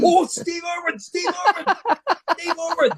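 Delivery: very high, excited imitation of fans. Mexicans in Dallas recognise him, mock-excited. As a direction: squeal the name in a mock high voice.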